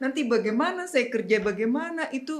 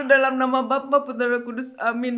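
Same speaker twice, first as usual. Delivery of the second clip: put-on raised-pitch Batak-accented sign of the cross; 5 semitones higher, 6 dB louder than usual. Mother opens prayer before crying, in Batak accent. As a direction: imitate Mom's Batak praying voice. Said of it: "Oh, logat Batak tuh".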